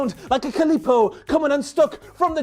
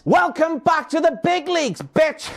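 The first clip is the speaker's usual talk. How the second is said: imitating Seth Rollins; 5 semitones higher than usual. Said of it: "Said Seth Rollins".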